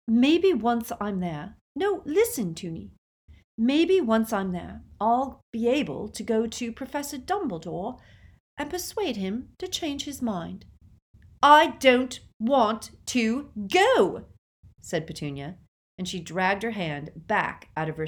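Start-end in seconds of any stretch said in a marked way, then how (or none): 0.1-10.7 high, urgent pleading. A narrator's reading. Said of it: "pleading with her sister". 11.4-14.3 loud, high, slow protest; angry refusal. A narrator's reading. Sisters quarrel at platform.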